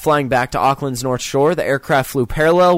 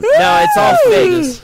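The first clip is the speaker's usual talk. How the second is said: shouted, much higher; loud; 5 dB louder than usual. Real, not acted. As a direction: yell it out over them. Protesting the yawn was fake.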